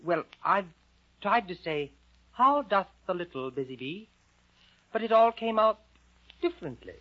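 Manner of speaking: put-on high, halting girl's voice; Alice's meek voice. Storyteller as Alice explaining to the caterpillar, meek and high. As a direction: explain in careful, high-pitched bursts. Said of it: "And Alice said".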